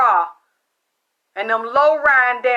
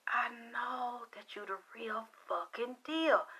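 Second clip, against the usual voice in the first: hushed quote; 16 dB quieter than usual. Retold or imitated. Psychic confiding to the teller, soft whispered confession. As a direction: let the secret out under your breath.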